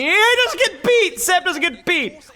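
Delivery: hype disbelief. Real, not acted. Streamer reacts to a clutch play.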